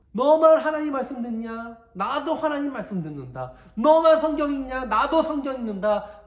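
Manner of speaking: high, loud taunting; mocking protest. Korah's faction protesting to Moses, loud and taunting. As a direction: throw each challenge in his face. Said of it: "그렇게 이야기하는 거예요".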